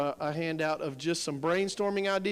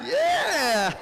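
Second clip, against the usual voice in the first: excited cheer; 11 semitones higher than usual. Real, not acted. Cheering a winning team.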